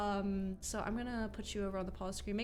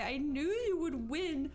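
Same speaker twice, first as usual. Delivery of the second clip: slow, higher, gentle quote; quoted character line; 5 semitones higher, 3 dB louder than usual. Acted. Streamer voices the future self, slow and higher. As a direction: voice the dying self's last smile, slowly. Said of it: "will smile and say".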